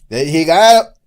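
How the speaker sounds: exaggerated shouted Mexican Spanish; loud, hammy Mexican hype; Mexican accent. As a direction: yell it high with an over-the-top Mexican accent. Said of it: "How do you do a Mexican accent?".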